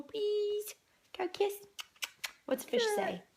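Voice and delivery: usual speaking voice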